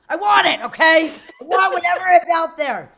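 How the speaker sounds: loud, high, insistent outburst; retold demand. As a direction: demand it with comic impatience. Retold demand for the special treatment.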